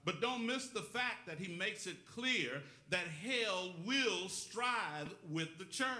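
preaching. Real, not acted. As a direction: preach it to the congregation, stretching the key words. Preaches the sermon.

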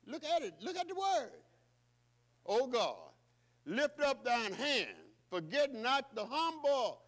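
loud, high, slow pleading cry; psalmist crying out. Acted. Preacher voicing the psalmist, loud slow plea. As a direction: call on God with full force. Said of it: "the psalmist say".

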